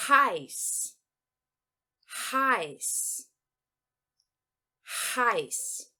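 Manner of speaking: heiß with "ai" sound, final s; German heiß. Shown as a demonstration. Teacher drilling heiß for students, clear German. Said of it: "Então, eu vou dizer".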